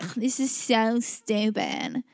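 slow, dismissive groan; mock disdain. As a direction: scoff it out slowly, put-on. Acting friend's dismissive reaction.

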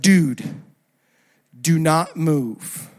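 low, slow, deliberate warning; tense warning. Retold or imitated. Worker warns driver about a spider, tense warning. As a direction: freeze them with slow, weighted words.

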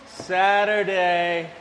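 slow, wistful, drawn-out Saturday; dreamy longing. Acted. Preacher's mind drifting back to Saturday, slow and wistful. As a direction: melt into the word like a daydream.

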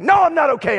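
put-on high, loud retort to his child; mad outburst. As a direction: snap back, mad, in a retold outburst. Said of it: "I got mad".

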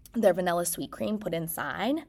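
normal talking voice

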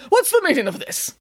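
high, put-on indignant old voice; indignant Santa. A narrator's reading. Santa woken on the couch, indignant demand. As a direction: demand answers, pitched high.

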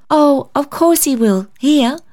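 put-on high, lively little girl's voice; child's cry. A narrator's reading. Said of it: "cried the child".

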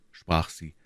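ordinary delivery, nothing marked

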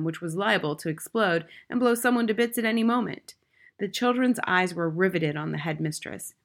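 ordinary delivery

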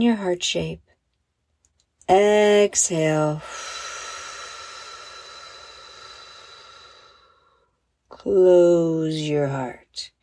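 very slow, drawn-out breathing cues; slow-motion guided breathing. Shown as a demonstration. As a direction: stretch each breathing cue with long pauses. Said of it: "but even more slowly".

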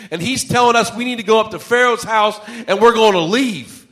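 high, loud, building to a peak; acted-out protest swelling into a sermon climax; impassioned preaching. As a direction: build to a forceful proclamation. Preaches Moses' speech, building.

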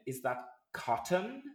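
"cotton" slow, with the middle T fully sounded; overpronounced consonants. Shown as a demonstration. Showing how an ex mocked her speech, "cotton" overpronounced. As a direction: ask it high and drawn out, over-articulating "cotton". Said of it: "I tend to overpronounce it".

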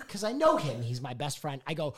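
ordinary delivery

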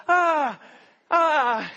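high, loud yelps, the second melting into a laugh; pained cries. Retold or imitated. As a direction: yelp in pain, then laugh it off. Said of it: "And I'm like".

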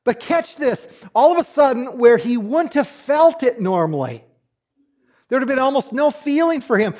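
ordinary talk, nothing marked

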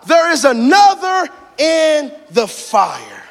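high, shouted declarations; ringing pulpit proclamation; full-force preaching. Real, not acted. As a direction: preach it triumphantly, stretching key words.

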